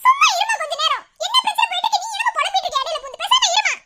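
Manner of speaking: high-pitched exasperated telling-off; angry. Acted. Daughter telling mother to stop lamenting, angry. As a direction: snap at her to stop interfering. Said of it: "கோவப்படாத".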